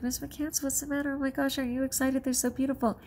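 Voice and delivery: high excited children's voices, lightly voiced; kids' voices. Students asking their teacher, voiced light and high. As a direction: pitch up like eager students. Kids voiced in retold story.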